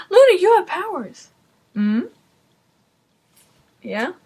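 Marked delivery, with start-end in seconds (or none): 0.1-1.3 high, loud blurted imitation; hammed-up abrupt announcement, pitched way up; mock sudden reveal. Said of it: "it's like".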